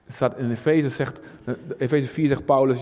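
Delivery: usual speaking voice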